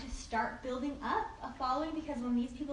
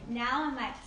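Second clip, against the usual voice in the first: normal talking voice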